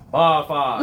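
loud drawn-out band yell; yelled catchphrase. Shown as a demonstration. Band kid demonstrating voice types, yelled. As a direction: belt it out to the band. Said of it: "from high pitch to".

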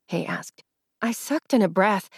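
ordinary delivery, nothing marked

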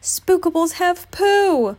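high, loud, drawn-out cry; Piglet's alarmed cry. A narrator's reading. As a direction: call out the alarm slowly and high. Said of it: "Piglet cried".

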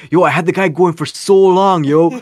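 loud, high-pitched bragging; imitated proud excitement. Friend reporting back on a scammer call, hammed-up pride. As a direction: crow it like a buddy boasting. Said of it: "all my friends are so proud".

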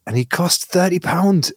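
high Glaswegian mum complaining; mock Glaswegian outrage; irate Glaswegian mother. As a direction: Glaswegian scold continuing. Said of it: "an irate Glaswegian mother being like".

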